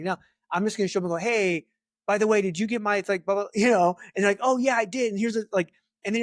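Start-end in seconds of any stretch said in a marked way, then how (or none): 1.2-3.0 light, lifted casual check-in; popping into a coworker's office, breezy and upbeat; breezy coworker greeting. Retold or imitated. Casual drop-by chat, acted. 4.4-5.1 bright, much higher easy reply; cheerful coworker reply. Retold or imitated.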